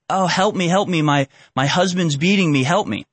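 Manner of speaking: a lightly imitated tearful plea for help; mock crying plea. Teller imitating a crying woman at the fence, mock tearful. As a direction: play her fake sobbing plea, not fully committed. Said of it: "she's, like, crying".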